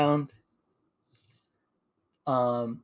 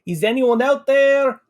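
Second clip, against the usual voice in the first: louder, higher call into the unknown; raised calling voice; 7 semitones higher, 4 dB louder than usual. Acted. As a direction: shout to anyone nearby. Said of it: "He'll just shout out".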